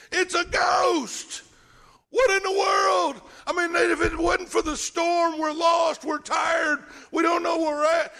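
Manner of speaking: high, loud terrified yelling; panicked shout. Acted. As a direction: scream it out like men lost at sea. Preacher acts panicked disciples.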